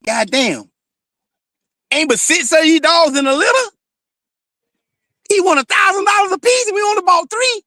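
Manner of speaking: hammed-up outrage over a dog price; mock exasperated yell. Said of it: "I beat him cussing me out".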